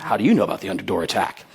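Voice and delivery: a hint of a suspicious manager. Manager asks the thief how he knows, low and quick. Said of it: "And they say".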